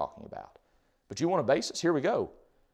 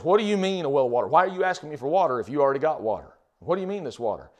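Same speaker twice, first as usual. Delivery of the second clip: loud, higher, challenging questions; arguing; 4 semitones higher, 7 dB louder than usual. Acted. Woman at the well questions a stranger's offer, arguing. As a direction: argue back, raised voice on each question. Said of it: "she's arguing with him".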